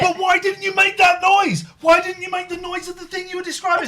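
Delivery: loud, high exasperated demand; indignant shouting; cross. Real, not acted. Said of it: "Let's not get cross".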